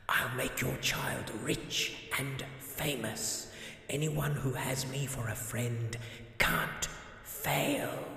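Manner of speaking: slow eerie whisper; slow, breathy Death voice. Acted. Death accepting the godfather role, slow and whispered.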